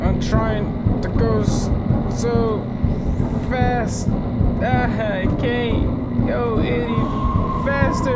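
loud, high, strained panic, easing toward the end; frantic self-urging. Real, not acted. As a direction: push yourself through fear, pumping yourself up. Crawling away from monster.

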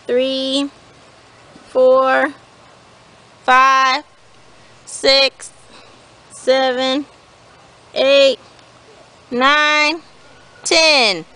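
evenly spaced counting, then drawn-out call; hide-and-seek count. Real, not acted. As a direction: count out steadily, then sing out the warning.

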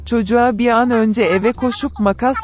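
plain reading voice

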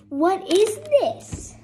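high, loud, slow disbelief; incredulous. Real, not acted. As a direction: stretch it out in disbelief. Repeated question, flat then raised.

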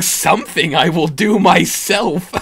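slow, loud, high menacing threat; sadistic relish. Retold or imitated. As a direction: savour every word. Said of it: "with a sadistic voice going".

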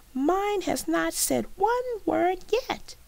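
put-on very high, slow girl's voice; drawn-out high schoolgirl lament; jealous girl. A narrator's reading. Jealous girl goes on speaking.

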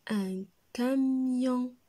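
camion drawn out slowly; slow syllables. Shown as a demonstration. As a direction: stretch out camion for the class. (camion lentement, syllabe par syllabe)

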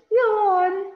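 a second bear's big high yawn; other bear yawning. A narrator's reading. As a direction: yawn it out high like a sleepy cub. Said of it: "And the other bear says".

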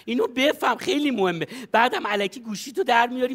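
usual speaking voice

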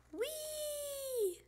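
long, high, soft squeal; joyful squeal rolling downhill; gleeful whee. A narrator's reading. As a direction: sail off on a high, drawn-out note. Riding off on bikes.

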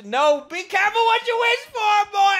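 high, loud warning yell; hyped-up threat; taunting shout. Real, not acted. Taunting opponent.

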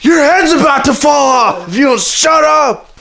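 high-pitched, exasperated mock yell; retold angry yell. Fed-up sibling yelling at little sister, mock-angry high yell. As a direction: threaten in hammed-up outrage.

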